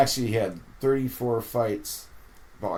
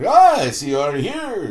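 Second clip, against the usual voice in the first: put-on groggy old skipper; 10 semitones higher, 10 dB louder than usual. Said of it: "he wakes up".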